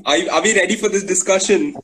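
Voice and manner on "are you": ordinary talk, nothing marked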